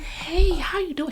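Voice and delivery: high, slow, smiling hello; cashier's beaming hello; big smiling greeting. Retold or imitated. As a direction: greet her with a huge smile. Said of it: "She smiled so big".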